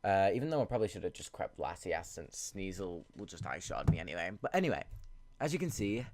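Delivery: ordinary talk, nothing marked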